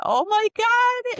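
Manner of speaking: high, loud, slow "oh my god"; shocked gasp. Retold or imitated. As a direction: stretch it out in retold disbelief. Shocked reaction to Frank's story.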